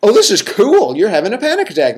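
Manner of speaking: the nerdy inner clinician thrilled by the attack; mock-gleeful inner voice marveling at its own panic; gleeful detached self. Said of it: "the other side of me that was realizing".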